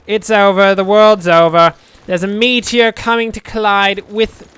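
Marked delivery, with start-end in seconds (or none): 0.1-1.7 loud hammed-up doom; mock doom wail. Builder declaring the world over, hammed-up loud wail. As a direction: announce the end of the world, loudly.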